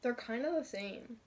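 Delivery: cloth over mouth; muffled by fabric; through a bandana. Real, not acted. Seen 3: Tie-dye bandana hangs over eyes, nose and mouth while speaking.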